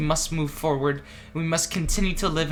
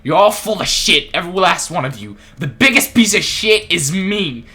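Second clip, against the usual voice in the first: louder, bitter self-blaming rant; angry rant; 3 semitones higher, 9 dB louder than usual. Acted. Character blaming friends and himself after the trial, angry. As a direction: scold everyone, then yourself.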